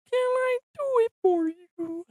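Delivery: high, soft squeaky puppet voice; Kermit voice. Retold or imitated. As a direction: squeak it like a Muppet frog. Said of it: "That, Have you seen that?".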